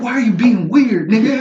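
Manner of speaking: loud, high-pitched mock complaint; mock outburst. Mock quote of a scene, laughing.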